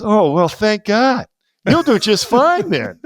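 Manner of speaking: high, slow relieved sigh of a teacher. Retold or imitated. Teacher relieved he only runs the company, high and slow. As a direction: let the relief out slowly, high. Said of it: "He goes".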